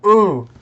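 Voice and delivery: high, loud "ooh" of surprise; surprised "ooh". Real, not acted. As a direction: let out a sudden, loud "ooh". Reacts to a sudden game move.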